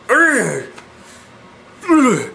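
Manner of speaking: disgusted groan. Real, not acted. Disgust at the new haircut.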